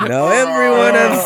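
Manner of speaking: laughing. Real, not acted. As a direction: laugh while saying it. Laughing mock line.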